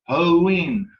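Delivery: "hoin" loud and high, with a long E. Shown as a demonstration. Teacher models the adverb for a student, long E. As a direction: punch out "hoin" with a long ee before the N. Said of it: "with that long E sound".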